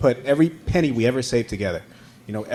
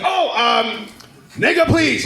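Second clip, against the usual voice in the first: dad's request; 8 semitones higher, much slower than usual. Retold or imitated. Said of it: "He's like".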